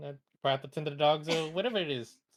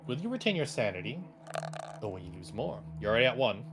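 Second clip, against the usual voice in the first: game master narration; 5 semitones lower than usual. Acted. DM narrates and calls roll.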